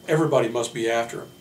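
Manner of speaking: normal talking voice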